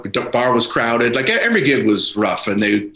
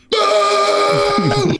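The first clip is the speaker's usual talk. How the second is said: boo him off the ice like seventeen thousand fans; huge crowd boo. Retold or imitated. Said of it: "seventeen thousand people".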